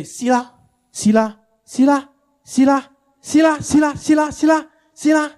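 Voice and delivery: high, insistent needling repetition; told-you-so taunting. Acted. Wife rubbing in husband's wrong decision.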